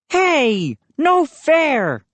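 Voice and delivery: high indignant declaration; protesting. A narrator's reading. High whiny protest. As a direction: Moose complains about the early serve, indignant. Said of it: "he declared".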